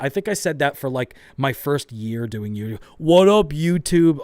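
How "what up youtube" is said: much louder, higher hyped greeting; mocking old intro. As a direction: parody your own cringe YouTube intro. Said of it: "I used to say that".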